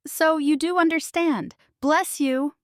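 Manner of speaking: crying out gratefully. Acted. Grateful baker cries out to the investigator. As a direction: blurt out your thanks. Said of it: "Evelyn cries".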